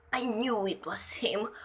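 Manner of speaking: hushed, low whisper; small pained child. A narrator's reading. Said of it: "He sounded like a small child in pain".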